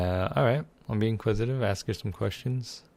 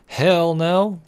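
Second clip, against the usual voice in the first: defiant shout; 10 semitones higher, 9 dB louder than usual. Pretend. Refusing to stop at purse.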